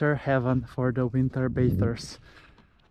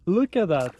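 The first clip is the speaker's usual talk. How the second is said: louder, delighted look at that; excited; 4 semitones higher, 4 dB louder than usual. Real, not acted. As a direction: point it out with delight. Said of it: "repeated Look at that".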